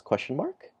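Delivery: high, puzzled rising question; Luffy puzzled. Acted. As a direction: say it high and baffled, like a confused cartoon boy. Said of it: "And Luffy says".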